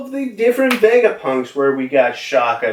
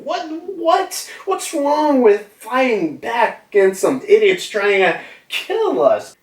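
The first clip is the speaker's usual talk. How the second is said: much higher indignant outburst; Lilith protesting; 10 semitones higher than usual. Retold or imitated. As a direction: argue back, offended. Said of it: "she's like".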